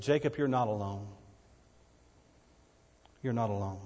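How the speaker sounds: God gently comforting Jacob at night; tender comfort. Retold or imitated. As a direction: soothe a frightened man.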